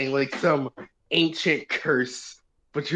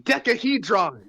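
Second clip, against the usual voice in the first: power-up call; 7 semitones higher, 3 dB louder than usual. Pretend. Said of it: "sound like a Power Rangers power-up".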